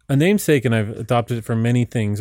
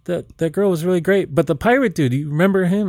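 excited fan; 7 semitones higher than usual. Retold or imitated. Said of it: "people were like".

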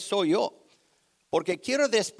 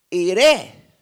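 loud prophet's reply; 9 dB louder than usual. Acted. Voicing Eliseo's reply.

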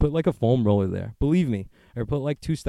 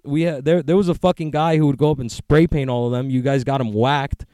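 usual speaking voice

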